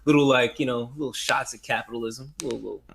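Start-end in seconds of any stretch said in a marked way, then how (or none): none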